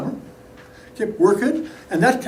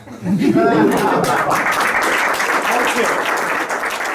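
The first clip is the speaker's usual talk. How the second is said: breathy laughing out; real laugh after the punchline; airy laugh. Real, not acted. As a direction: let the laugh spill out. End of story laughter.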